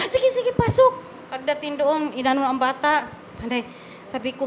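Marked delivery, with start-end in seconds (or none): 0.1-1.0 higher, hurried guard waving them through; lightly voiced busy hospital guard; brisk guard. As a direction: play the guard rushing them in.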